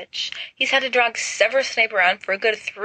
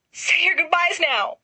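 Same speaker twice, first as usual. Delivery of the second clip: much higher, theatrical wail; "your" pitched up high and wailed; higher wailing moan; 12 semitones higher than usual. A narrator's reading. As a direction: Sirius wailing as the armor moves. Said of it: "Sirius moans".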